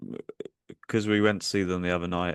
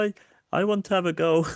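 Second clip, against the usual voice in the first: mock whiny lad; 10 semitones higher, 4 dB louder than usual. Angry lads demand a go.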